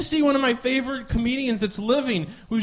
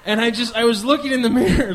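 ordinary delivery

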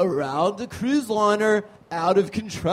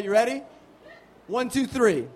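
ordinary delivery

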